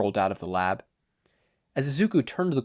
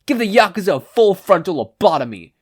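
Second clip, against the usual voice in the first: higher, loud reckless command; 8 semitones higher, 9 dB louder than usual. Acted. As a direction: bark an extreme order. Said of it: "Kodo commanded".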